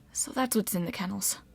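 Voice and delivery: very soft, small voice; hushed, daunted remark; meek. A narrator's reading. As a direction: say it timidly under your breath. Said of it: "Leo said meekly".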